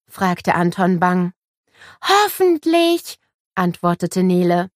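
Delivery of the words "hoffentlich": hoch und langsam, sehnsüchtig gedehnt; hoffnungsvolles Kind. A narrator's reading. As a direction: zieh das Wort voller Hoffnung lang. Nele hofft, Kind spricht. (Nele hofft auf dieselbe Klasse: hoch, langsam, sehnsüchtig)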